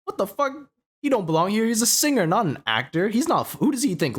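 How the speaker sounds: high, indignant rant; mock outrage. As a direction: act offended. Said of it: "And I was like".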